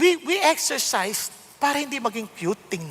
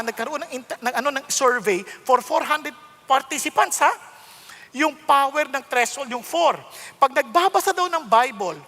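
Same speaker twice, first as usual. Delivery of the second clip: usual speaking voice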